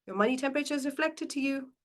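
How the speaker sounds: normal talking voice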